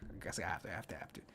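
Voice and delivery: whispered to self. Real, not acted. Grudging aside to self.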